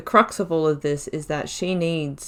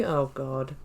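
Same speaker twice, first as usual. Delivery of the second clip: ordinary talk, nothing marked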